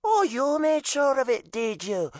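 high sarcastic Irish mother; scoffing Irish mom; Irish accent. A narrator's reading. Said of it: "scowling in his direction".

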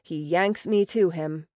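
ordinary delivery, nothing marked

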